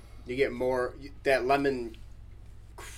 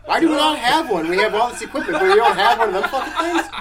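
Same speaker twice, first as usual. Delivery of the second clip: laughing; 8 semitones higher, 8 dB louder than usual. Real, not acted. Laughing disbelief at missing gear.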